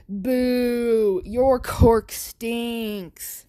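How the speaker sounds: loud, very slow jeering boo; mocking bully taunt. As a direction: drag out the jeer like a playground bully. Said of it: "And Bakugo's like".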